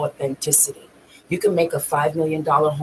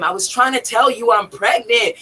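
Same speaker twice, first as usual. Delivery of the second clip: higher-pitched exasperated outburst; raised voice, mildly acted out; retold yell; 7 semitones higher than usual. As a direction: re-enact yelling at him. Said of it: "I yelled at him".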